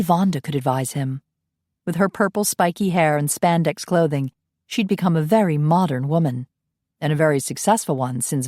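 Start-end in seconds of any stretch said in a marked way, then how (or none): none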